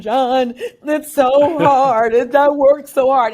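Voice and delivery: mock sob the words out to a mentor; retold crying plea. To mentor John, a mock tearful plea. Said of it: "I'm crying to you going".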